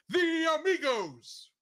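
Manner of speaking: high, loud, drawn-out flourish; mock-grand announcer shout; hammed-up announcing. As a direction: belt out the name like a big reveal. Reads summary, hams up final name.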